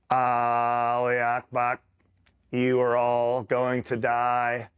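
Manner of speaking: slow, droning radio voice; stretched-out, level delivery of an enemy threat; imitated radio relay. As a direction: mimic a drawn-out verbatim radio relay. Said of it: "he's repeating what they're saying verbatim".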